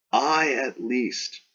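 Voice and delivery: "I" held long and high; slow declaration leaning on "I"; emphatic I. Acted. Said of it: "this is an emphatic I".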